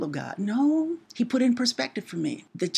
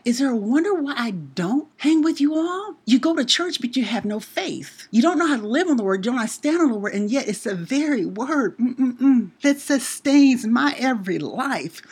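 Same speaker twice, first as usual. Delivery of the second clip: retort to family; 5 semitones higher, 5 dB louder than usual. Retold or imitated. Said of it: "I'm like".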